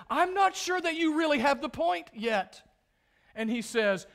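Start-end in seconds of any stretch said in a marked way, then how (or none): 0.1-2.6 much higher, louder voice for God pressing on; God pressing Job further, higher and louder; God from the whirlwind. Retold or imitated. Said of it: "He continues to speak out of the whirlwind and says".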